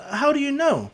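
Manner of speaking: put-on high-pitched, surprised girl voice; shocked. A narrator's reading. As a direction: ask it high, taken aback. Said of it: "she's, like, shocked, really shocked".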